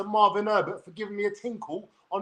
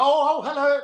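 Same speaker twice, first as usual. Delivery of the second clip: higher, mock-innocent hello; put-on innocent voice; 7 semitones higher, 4 dB louder than usual. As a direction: play innocent on the phone, pitched up. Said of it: "trying to be innocent voice".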